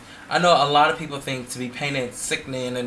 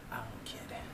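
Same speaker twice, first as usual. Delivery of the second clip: whisper; 3 semitones lower, 19 dB quieter than usual. Real, not acted. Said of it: "It's hilarious".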